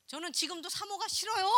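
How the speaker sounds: usual speaking voice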